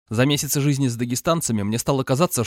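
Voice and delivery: plain reading voice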